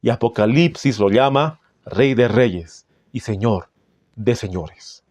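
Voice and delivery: normal talking voice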